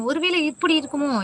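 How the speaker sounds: high, teasing wonder; playful guessing. Acted. Host teasing viewers while guessing, high and playful. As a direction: muse aloud in a sing-song, mock-curious way.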